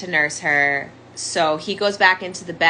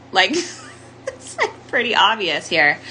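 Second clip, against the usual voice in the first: bitter laugh; 2 semitones higher than usual. Real, not acted. Bitter laugh at own story.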